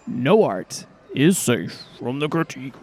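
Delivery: put-on wrestler's growling threat. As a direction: do a menacing pro-wrestler voice. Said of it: "That was my wrestler voice".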